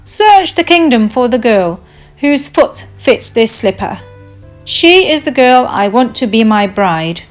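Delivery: calling out. Acted. Prince sending his butler to search, calling out. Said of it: "The prince called out to his butler".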